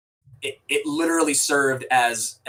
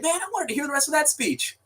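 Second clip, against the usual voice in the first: high, put-on voice of a disappointed dude; mock the guy who missed the speech; lightly hammed-up dude voice; quoting a movie guy; 14 semitones higher, 3 dB quieter than usual. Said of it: "the dude's like".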